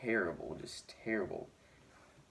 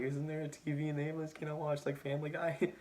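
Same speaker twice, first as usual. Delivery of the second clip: higher, playful mock-innocent voice asking for TV; lightly joking, like a bored kid in the ambulance; joking request; 7 semitones higher, 3 dB quieter than usual. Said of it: "I just was kidding".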